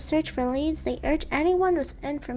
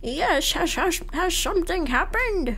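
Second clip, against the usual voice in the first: grandpa voice. Acted. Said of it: "I don't want to do the grandpa voice anymore".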